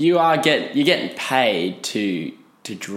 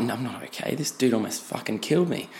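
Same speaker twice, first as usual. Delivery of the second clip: much softer private aside; inner thought; 7 dB quieter than usual. Retold or imitated. Passenger silently disagreeing after a near crash, hushed inner thought. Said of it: "In my head, I'm like".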